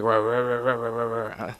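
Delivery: mock a UK southern accent as wordless rah-rah noise; mimicked UK southern accent. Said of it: "It sounds so, like".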